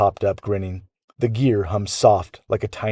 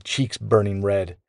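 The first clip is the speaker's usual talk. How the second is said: usual speaking voice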